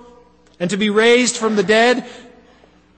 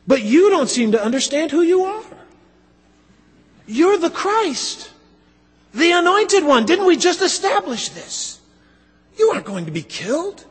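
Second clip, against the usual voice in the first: higher, insistent disbelieving plea; Peter's indignant rebuke; 8 semitones higher than usual. Pretend. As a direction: argue like a friend who can't accept it. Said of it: "You can just imagine Peter's words here".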